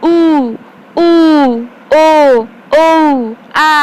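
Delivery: vowels sounded out one by one; back vowels demo. Shown as a demonstration. Teacher sounding out back vowels for pupils. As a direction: model each back vowel for the class. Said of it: "ପଶ୍ଚସ୍ୱର".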